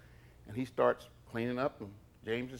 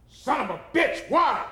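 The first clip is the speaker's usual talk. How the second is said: very high, loud furious yell; retold furious shout; 6 dB louder than usual. Elvis exploding at James the thief, a loud high yell. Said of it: "Like that".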